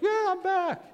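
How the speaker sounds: very high, put-on cheer; jubilant younger brother. Younger brother announcing his return, hammed-up joy. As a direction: squeal it like a triumphant homecoming. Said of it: "younger brother's like".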